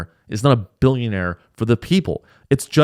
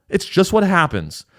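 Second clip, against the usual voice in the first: usual speaking voice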